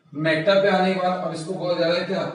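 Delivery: ordinary talk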